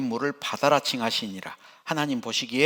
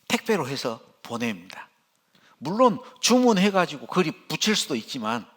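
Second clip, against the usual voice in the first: usual speaking voice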